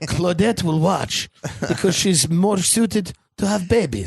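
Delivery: slow, lisping chef impression; mock a lisping, accented TV chef; Jacques Pepin with a lisp. TV chef hands his daughter her role, slow mock lisp. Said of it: "He has the lisp, right?".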